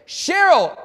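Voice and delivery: high, loud delighted call of her name; big excited hello; excited greeting. Retold or imitated. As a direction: greet her like a long-lost friend.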